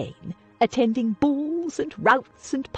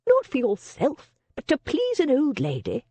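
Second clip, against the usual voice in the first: higher, hammed-up plaintive plea; theatrically pitiful, not meant to convince; mock-pathetic; 7 semitones higher than usual. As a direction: overplay the poor old lady act. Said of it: "unconvincing, mock-pathetic expression".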